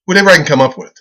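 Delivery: ordinary talk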